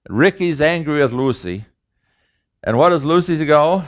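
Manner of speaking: ordinary talk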